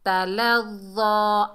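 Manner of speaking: slow chant, final ा stretched; recited slowly. Shown as a demonstration. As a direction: draw out the last vowel. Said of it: "खड़े ज़बर को एक अलिफ की मिकदार खींच कर".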